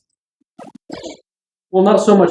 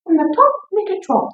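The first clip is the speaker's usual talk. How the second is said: sing-song call; 13 semitones higher than usual. Acted. Riding banter, sing-song call.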